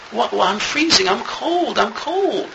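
put-on high, whimpering child's voice; crying little child. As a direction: play a small boy crying to his dad. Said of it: "And he's crying to his father".

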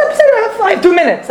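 high-pitched mimicked excuse; mock protest. Man on his phone outside shul before Mincha, mock high protest. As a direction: send the voice way up, imitating a staller.

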